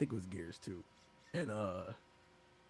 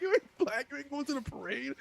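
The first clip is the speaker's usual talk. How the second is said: much higher sing-song taunt; mock high taunt. Online player taunting the speaker in a much higher mock voice. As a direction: tease him in a squeaky high voice. Imitating teasing gamer again, laughing.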